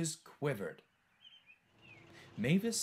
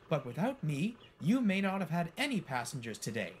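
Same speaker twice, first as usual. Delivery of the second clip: higher, indignant comeback; fuming; 5 semitones higher, 3 dB louder than usual. A narrator's reading. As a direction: hit back, mildly heated. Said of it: "fumed Mavis".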